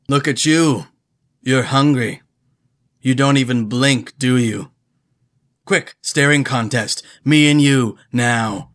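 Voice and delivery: loud, booming lounge-singer yell; booming [name] voice. Acted. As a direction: belt it out like a brash crooner. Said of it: "In a booming voice, I did my best impression of Will Ferrell as [name]".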